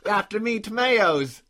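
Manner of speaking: loud, laughing mock Australian that slips into Cockney; a comic Cockney-ish Aussie accent, laughing; botched Australian going Cockney. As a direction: shout a clumsy Aussie accent that turns Cockney. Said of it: "I guess I went Cockney there".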